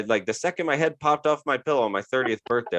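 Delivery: normal talking voice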